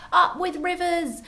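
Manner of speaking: high, joyful hooted cheer; hooting with delight. A narrator's reading. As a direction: hoot it out in delight. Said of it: "And they hooted".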